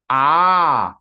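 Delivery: A held three times as long, one steady long "aah"; extended plutaha vowel. Shown as a demonstration. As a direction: stretch the A into a long, sustained "aaah". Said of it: "It is extended three times".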